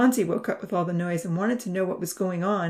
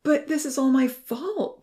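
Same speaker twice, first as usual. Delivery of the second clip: slow, high, remorseful wail; distressed, drawn-out protest; crying out; 8 semitones higher, 4 dB louder than usual. A narrator's reading. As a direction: cry it out in guilt. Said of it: "Ponzi cried".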